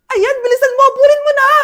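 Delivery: hammed-up shout at a fleeing kid; mock angry yell. Mother chasing her son, hammed-up angry yell.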